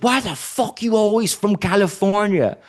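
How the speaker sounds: high-pitched mock-indignant outburst; imitated outrage. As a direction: complain like an exasperated rowdy stranger.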